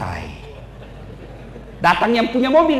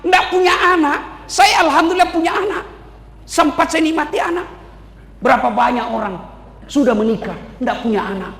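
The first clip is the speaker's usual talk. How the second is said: usual speaking voice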